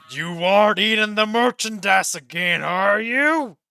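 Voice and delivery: loud, booming accusation in a rough voice; angry boss. Acted. The boss accuses Roka of eating the merchandise, booming. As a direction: loom over him and accuse.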